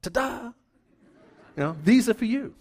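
high, bright-eyed ta-da with a laugh; cheery ta-da. Retold or imitated. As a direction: present the flowers with a showy flourish. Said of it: "and I'll just go".

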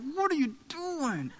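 high, drawn-out, restrained "what are you doing"; mock furious. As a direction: scold in exasperation without volume. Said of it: "I'm furious".

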